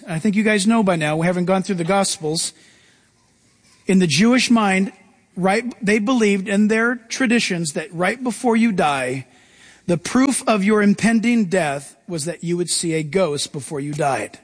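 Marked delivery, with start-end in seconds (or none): none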